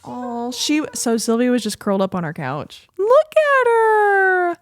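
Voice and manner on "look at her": high, slow and loud coo at the dog; much higher, drawn-out doggy talk; cooing pet voice. Real, not acted.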